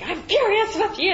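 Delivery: high, loud mock of an angry dad; dad's furious line. Retelling her dad yelling at her, high and loud. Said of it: "he was like".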